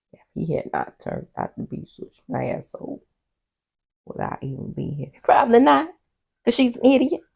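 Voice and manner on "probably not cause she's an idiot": loud, high blunt jab; blunt sassy answer. Real, not acted. Host answering the woman's question, sassy and loud.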